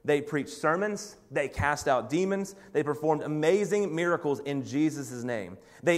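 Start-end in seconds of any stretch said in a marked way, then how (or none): none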